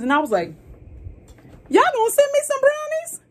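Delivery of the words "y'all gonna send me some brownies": very high, loud disbelieving squeal; excited squeal. Retold or imitated. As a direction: squeal it like you can't believe your luck. Retold excited reply to brownie offer.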